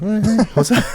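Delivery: high, laughing mock hello; high goofy greeting. Friend retelling a goofy wave at the crowd, high and laughing. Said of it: "I'm just like".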